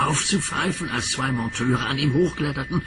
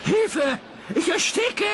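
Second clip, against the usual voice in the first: Thomas screaming; slower than usual. A narrator's reading. Thomas stuck under the coal, screaming very high for help. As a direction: shriek it as if choking. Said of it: "schrie Thomas".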